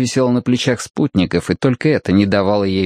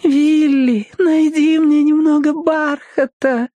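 very high, drawn-out sugary wheedle; fake-tender whine hiding a threat; childlike begging; slower than usual. A narrator's reading. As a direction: beg like a child after a lollipop. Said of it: "Она говорила как ребёнок, выпрашивающий леденец".

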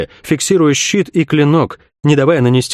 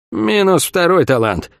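higher, slow, smiling delivery; smiling taunt; 5 semitones higher, slower than usual. A narrator's reading. Older fighter taunts her angry opponent, smiling. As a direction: say it through a slight smile, drawn out. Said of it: "улыбнулась Сойка".

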